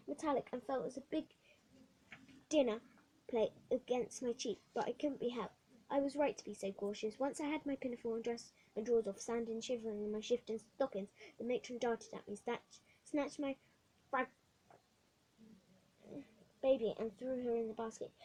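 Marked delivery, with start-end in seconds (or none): none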